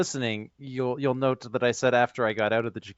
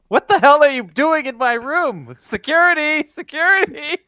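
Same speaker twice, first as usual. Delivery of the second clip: very high shrieking outrage at an intruder; a star shrieking for security in her hotel room; outraged yell; 14 semitones higher, 8 dB louder than usual. Acted. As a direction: scream for security, higher each time. [name] yelling at intruder.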